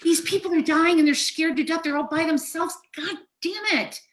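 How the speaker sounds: real upset, voice pitched very high; overcome, pleading on behalf of the dying; high, distraught outpouring; emotional. Real, not acted. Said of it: "I'm gonna get emotional here".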